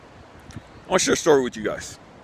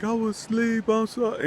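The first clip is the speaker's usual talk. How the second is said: put-on much higher, small child's apologetic voice; sleepy daughter; 13 semitones higher than usual. Daughter apologizing to her mom after falling asleep, high childlike voice. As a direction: imitate a little girl caught out. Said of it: "she's like".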